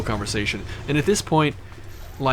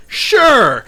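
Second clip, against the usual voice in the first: excited yes; 10 semitones higher, 10 dB louder than usual. Retold or imitated. Group agrees to go to party.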